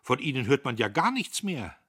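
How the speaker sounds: erzwungene Munterkeit. A narrator's reading. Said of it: "mit erzwungener Munterkeit".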